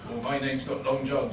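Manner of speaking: ordinary delivery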